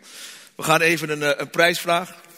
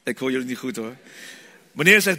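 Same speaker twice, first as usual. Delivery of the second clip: ordinary delivery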